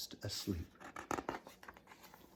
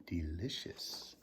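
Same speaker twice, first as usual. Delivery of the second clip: whispered relish; 6 semitones lower, 9 dB quieter, slower than usual. Acted. Giant savoring smell.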